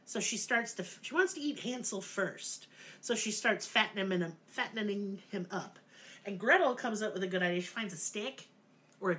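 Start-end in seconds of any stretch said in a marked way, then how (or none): none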